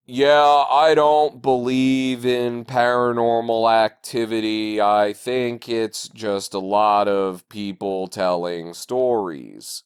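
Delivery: low, slow deadpan actor imitation; mocking the actors' line. Podcast host mimicking the film's flat line delivery. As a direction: drone it like a bored bad actor. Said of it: "That was actually better than how they said it".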